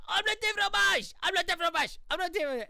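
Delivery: high, loud frantic yell. Retold or imitated. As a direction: shout it in desperation. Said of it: "he tries to yell".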